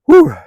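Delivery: loud high exhale of effort; winded whew. Real, not acted. Hiker climbing a steep hill, loud winded whew. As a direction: blow it out, real winded relief.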